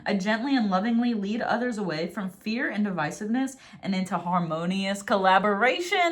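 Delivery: ordinary talk, nothing marked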